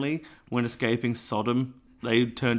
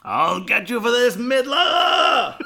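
shouted cartoon villain threat, higher; sputtering foiled villain; 11 semitones higher, 7 dB louder, slower than usual. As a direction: shake your fist, louder and higher. Cartoon villain send-off line.

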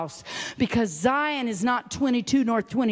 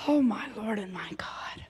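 awed whisper; 5 dB quieter than usual. Acted. Awed hush at the scripture.

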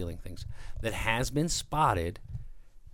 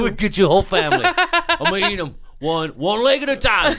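mock threat; 10 semitones higher, 6 dB louder than usual. Continues the bear's threat.

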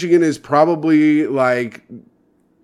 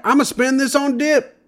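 Michigan lottery winner impression; 12 semitones higher than usual. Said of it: "my impression of what I think he sounds like".

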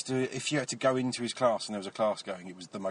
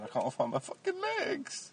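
anguished movie quote; 4 dB quieter than usual. Retold or imitated. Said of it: "and the bloke said".

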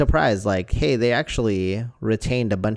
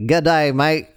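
hammed-up Australian accent, a bit louder; mock Australian accent; 3 semitones higher, 4 dB louder than usual. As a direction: play a cheerful Australian tourist greeting in an Australian accent. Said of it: "what's, like, the most beautiful accent?".

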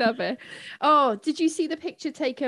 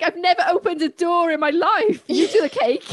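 hint of the Queen; 6 semitones higher, 4 dB louder than usual. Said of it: "She's like".

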